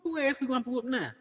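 high, fast eager challenge; pumped-up high retort; ready to fight. Retold or imitated. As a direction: rush it out ready to brawl. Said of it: "I'm thinking about".

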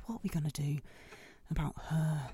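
worried hushed whisper. Acted. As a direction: plot quietly, out of her earshot. Whispering about the girl.

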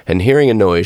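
ordinary delivery, nothing marked

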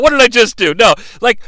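normal talking voice

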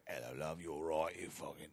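low, soft mock Cockney. Shown as a demonstration. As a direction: demonstrate a caricature Cockney greeting, kept low. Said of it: "so that's Cockney".

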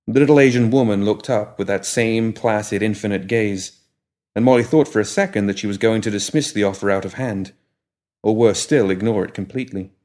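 ordinary delivery

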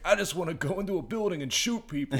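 high-pitched, exasperated mock outburst; mad cop. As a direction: whine it angrily like a frustrated cop. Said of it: "he's so mad".

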